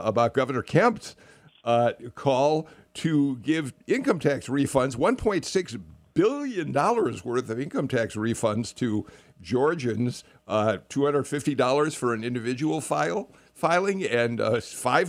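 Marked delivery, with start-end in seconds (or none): none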